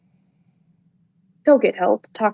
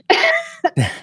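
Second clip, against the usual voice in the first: nervous emotional laugh. Real, not acted. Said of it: "this is an emotional topic".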